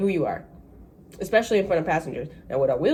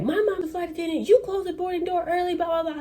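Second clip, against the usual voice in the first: pitched up, indignant tirade; indignant passenger; 10 semitones higher than usual. Retold or imitated. Another passenger confronting the agent, high indignant tirade. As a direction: tell him off like an offended customer. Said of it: "and she's like".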